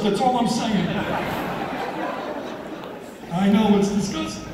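ordinary delivery

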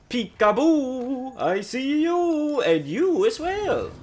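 slow, sing-song teasing; playful peekaboo taunt. Real, not acted. Streamer taunting the enemy he just spotted, slow and teasing.

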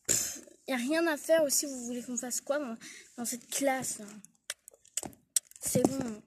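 ordinary talk, nothing marked

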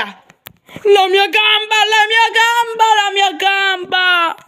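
exaggerated pained cartoon cry; mock wail. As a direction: moan it out like a hammed-up cartoon injury. Cartoon character hurt leg.

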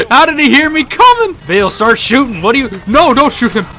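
high, loud panicked protest; alarmed shout. Real, not acted. Player to Bill as shooting starts, alarmed shout. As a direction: yell at your partner to stop.

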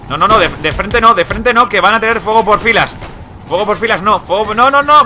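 rising alarmed cries, pitch climbing; panicked shouting. Real, not acted. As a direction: yell no in growing panic. Troops charging into firing lines.

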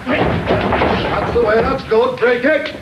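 calling out encouragement. Real, not acted. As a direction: call out approval to the wrestlers mid-bout. Coach calling out during sparring.

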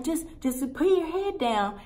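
high mimicked assistant voice; assistant's urging. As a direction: imitate the assistant insisting, pitched high. Voices assistant's repeated instruction.

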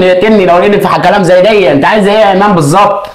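angry outburst. Acted. Brother-in-law raging at his brother's wife, heated. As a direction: blow up and announce you're leaving.

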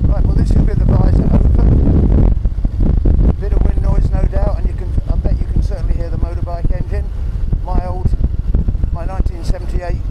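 speaking up over wind and engine noise; half-shouting inside a helmet. Real, not acted. Talking while riding motorbike.

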